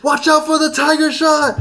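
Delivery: high-pitched mock yell with laughter; hyped-up spectator yell, not full volume; re-enacted yelling. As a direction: play back a yelled warning from the crowd. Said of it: "like, yelling".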